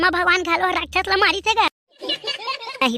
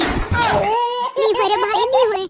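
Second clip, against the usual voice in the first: laughing protest; 5 semitones higher, much slower than usual. Acted.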